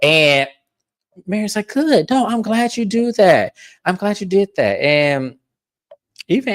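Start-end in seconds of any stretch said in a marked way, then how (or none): 1.7-4.8 lightly imitated high-pitched approval; a touch of prim, high satisfaction; Mary. Mary approves of Heather's call-out, lightly voiced.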